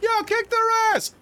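high, loud heckling yell; rowdy crowd shout. Acted. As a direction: yell from the stands. GM voices cheering audience member.

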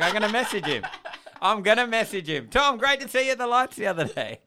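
high, loud, barely holding back laughter; laughing through the quote. Retold or imitated. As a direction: re-enact it through helpless laughter. Acting out his gleeful message.

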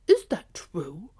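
slow, loud gruff question; questioning dog. Acted. Dog questions the cat's story, slow and loud. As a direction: ask it slowly in doubt as the dog. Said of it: "questioned Blackfoot".

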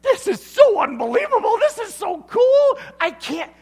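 very high, loud gushing; thrilled squeal. Retold or imitated. Wife shrieks with joy at the news. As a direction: gush like you can't believe it. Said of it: "Or it's like".

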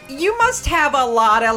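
normal talking voice

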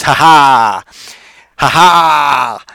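loud taunting ha ha; mock gloating laugh. Real, not acted. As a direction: gloat at the audience. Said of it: "there it was".

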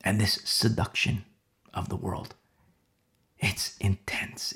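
usual speaking voice

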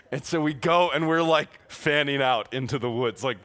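ordinary delivery

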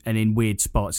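ordinary delivery

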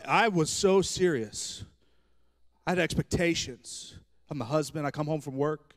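normal talking voice

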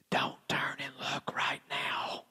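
slow, secretive whisper. Retold or imitated. Speaker warning two coffee companions not to look, whispered. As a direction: whisper it under your breath to friends. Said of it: "I whispered to Doug and to Anna".

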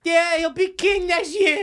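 very high, slow cartoonish voice; high silly voice. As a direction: squeak it out slowly. Joins high voice bit.

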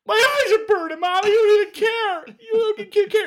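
put-on very high, loud, wailing child's voice; whiny crying kid. Dad imitating his son whining to mom about burning eyes, high wailing kid voice. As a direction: wail it like a hurt little boy blaming mom. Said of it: "And Logan's like".

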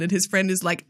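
ordinary talk

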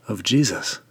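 ordinary delivery

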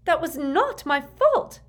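high, slow, loud denial; heated protest. A narrator's reading. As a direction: protest each word indignantly.

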